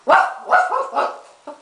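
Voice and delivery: high yappy mock woofs; imitated barking. Puppy Josie yapping at Jackie, very high. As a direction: yap it like a tiny puppy. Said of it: "Josie looks at Jackie".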